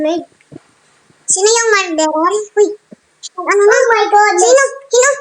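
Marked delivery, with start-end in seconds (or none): none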